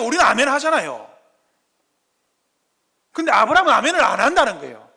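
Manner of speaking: loud, rising pulpit voice calling to the congregation; loud, high impassioned sermon delivery; full-force preaching. Acted. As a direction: preach it out with mounting fervor.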